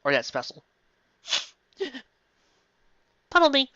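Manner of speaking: ordinary talk, nothing marked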